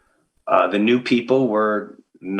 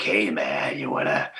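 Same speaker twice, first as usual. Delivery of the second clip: laid-back star; 10 semitones lower, 3 dB quieter than usual. Retold or imitated. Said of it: "they're like".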